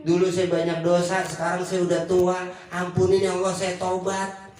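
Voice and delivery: low, slow, pleading repentance; humble prayer. Retold or imitated. Preacher voices a sinner confessing to God, low and slow. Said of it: "udah ngaku".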